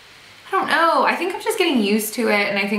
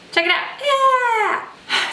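excited; much slower than usual. Real, not acted. Excited cheer pointing at video.

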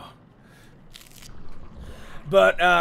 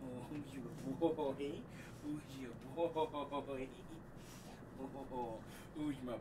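hushed cooing to the puppy; soft pet talk; 3 semitones lower, 23 dB quieter, faster than usual. Real, not acted. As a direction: coo softly to your dog. Talking softly to pet puppy.

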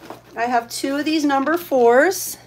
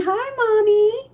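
high, slow whimpering pet; crying pet voice; 9 semitones higher than usual. Pretend. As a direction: whine it like a cat begging its owner. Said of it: "Everyone's saying".

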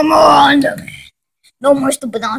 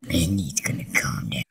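whisper; 16 dB quieter, faster than usual. Real, not acted. Aside while someone rages.

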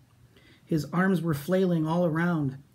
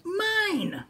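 long high wail. Acted. Minosaur wails in a tug of war, high. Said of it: "he wailed".

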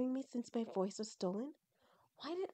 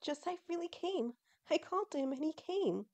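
put-on high-pitched, thrilled girl character; excited delight; 8 semitones higher than usual. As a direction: gush in a raised, happy voice. Shireen joyful at Joseph's coming.

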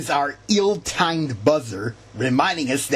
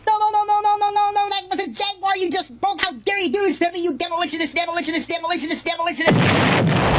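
high-pitched, racing screaming fit; tantrum; much faster than usual. Acted. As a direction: throw a shrill, rapid tantrum. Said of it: "Tantrum is coming on".

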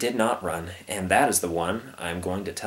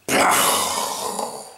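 explosive mouth-made bang; gunshot sound effect. Acted. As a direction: burst of breathy noise for a gunshot. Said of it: "really good at sound effects".

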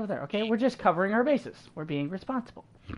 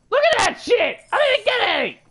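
high, loud indignant shout; outraged yell; 14 semitones higher, 4 dB louder than usual. Real, not acted. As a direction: yell it out in disbelief. Loses gold to partner.

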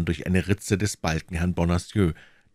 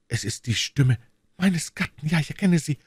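put-on alarmed young woman; 10 semitones higher than usual.

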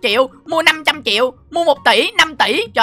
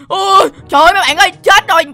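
high-pitched cry of dismay; acted alarm; 5 semitones higher, 4 dB louder than usual. Host telling viewers of the pigsty fire, alarmed. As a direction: play up sudden panic.

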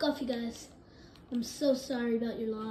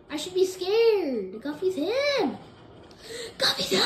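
loud, raised hype voice; excited yelling; 5 semitones higher, 5 dB louder, slower than usual. Real, not acted. Gamer hyping up a player, excited yelling. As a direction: yell it out in awe.

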